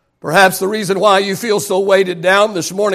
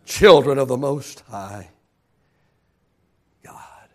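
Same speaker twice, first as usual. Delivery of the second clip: slow, low drop fading almost to a whisper; hushed reverence; 7 semitones lower, 4 dB quieter, slower than usual. Real, not acted. Preacher naming whose we are after the climax, hushed and fading. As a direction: let it sink away reverently.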